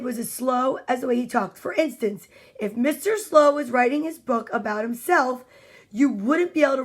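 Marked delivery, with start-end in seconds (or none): none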